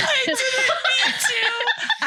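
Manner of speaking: very high, drawn-out squeal of laughter; real laughing squeal, slow and high; helpless laughter. Real, not acted. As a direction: plead innocence while cracking up. Laughing at her own mistake.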